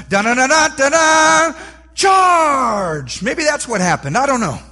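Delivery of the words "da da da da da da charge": loud sung fanfare into a shouted charge; trumpet fanfare then charge. Pretend. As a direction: sound the bugle and yell charge. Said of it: "the trumpets played ... and went".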